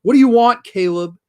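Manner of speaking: loud, high, impatient demand; mean. Retold or imitated. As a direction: imitate a woman snapping rudely at her gardener. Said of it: "being mean unnecessarily".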